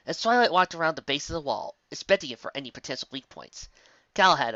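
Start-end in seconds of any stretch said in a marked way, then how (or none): none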